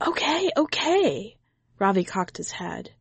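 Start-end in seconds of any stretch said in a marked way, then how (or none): 0.1-1.4 put-on child. A narrator's reading.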